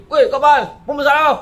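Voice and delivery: high, loud angry yell; screaming. Retold or imitated. Nerve-disorder sufferer yelling at people, loud and high. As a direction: snap at them full voice. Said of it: "Itu pun menjerit".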